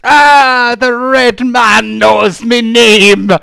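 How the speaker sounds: high, loud, drawn-out pirate wail; unhinged castaway hollering in alarm; alarmed pirate woman. Acted. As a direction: shriek it in crazed astonishment. Unhinged pirate woman startled by stranger.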